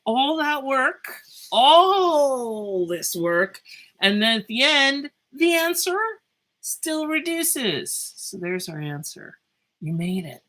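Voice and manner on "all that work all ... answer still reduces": high, loud and drawn out; excited. Real, not acted. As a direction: marvel at it, slowly and loudly. Excited reveal of cancellation.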